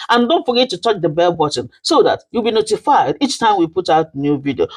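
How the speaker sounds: usual speaking voice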